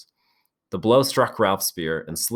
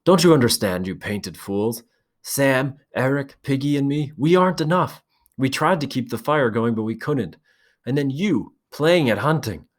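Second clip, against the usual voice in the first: higher, loud, breaking angry voice; voice cracking with rage; 7 semitones higher, 3 dB louder than usual. A narrator's reading. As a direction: let the voice crack with fury. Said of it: "His voice cracked".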